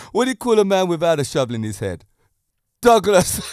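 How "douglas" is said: louder, higher punchline; gleeful punchline. Real, not acted. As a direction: deliver the punchline with a grin. Punchline of the joke.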